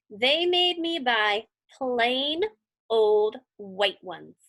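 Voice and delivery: plain reading voice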